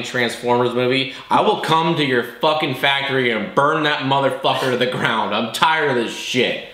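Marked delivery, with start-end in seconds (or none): none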